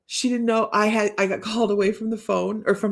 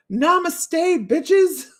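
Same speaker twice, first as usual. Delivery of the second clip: sassy punchline; 8 semitones higher, 5 dB louder, much slower than usual. Acted. Reading card punchline.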